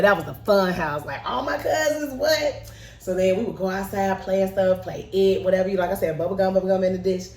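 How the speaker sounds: ordinary delivery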